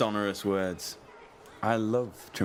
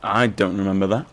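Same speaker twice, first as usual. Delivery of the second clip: loud protest; 8 dB louder, faster than usual. Acted.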